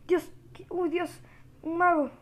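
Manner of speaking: high startled exclamation. Real, not acted. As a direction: react with a jolt to a sudden enemy. Startled by a mage appearing.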